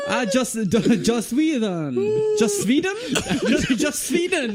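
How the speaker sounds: put-on high, slow laughing Swedish-ish accent. Co-host tries German but lands on a laughing Swedish accent. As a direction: drift into a silly Swedish voice. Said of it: "You went Swedish".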